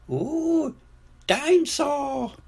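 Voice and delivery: very high little piglet voice; slow, high-pitched toddler wonder; George. A narrator's reading. George spots a dinosaur in the shop, high awed squeal. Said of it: "says George".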